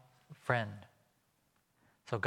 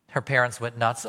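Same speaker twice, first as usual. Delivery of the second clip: ordinary talk, nothing marked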